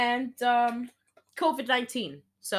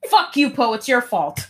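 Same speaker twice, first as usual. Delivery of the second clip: angry yell; 5 semitones higher, 9 dB louder than usual. Retold or imitated. Anger yelling at Poe.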